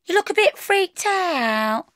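high concerned woman; woman's voice. A narrator's reading. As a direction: voice her worry lightly. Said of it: "she said".

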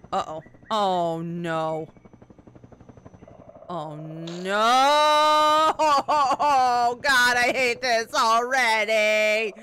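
loud, dreading oh no; scared gamer panic. Real, not acted. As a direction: groan in dread at the level. Panic at scary level.

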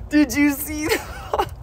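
high, loud, giddy; thrilled she ate it; delighted laughing. Real, not acted. Owner thrilled his possum ate the blueberry, giddy.

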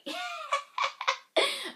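a high, giggly burst of laughter. Real, not acted. Vlogger laughing about pre-ordering at six a.m. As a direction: laugh at yourself, amused.

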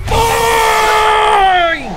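a huge high yell, cut off and furious; yelled curse. Real, not acted. Streamer screaming a curse at the game mid-fight.

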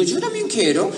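put-on high, eager child's voice; eager kid. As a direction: chime in like a keen kid. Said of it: "Y digo".

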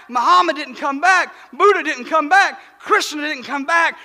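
ordinary delivery

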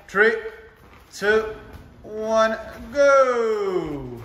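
loud, high countdown yell; shouting countdown. Real, not acted. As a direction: fire them off with a shout. Coach counting in last round.

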